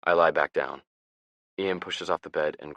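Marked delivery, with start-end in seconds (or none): none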